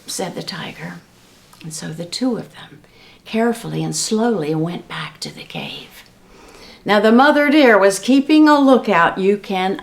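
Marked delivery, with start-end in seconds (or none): 0.1-6.0 hushed, unvoiced narration; whisper. A narrator's reading. Narrator tiptoes the tiger and jackal back to the cave, whispering. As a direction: whisper it as they creep back. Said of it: "narrator whispers carefully".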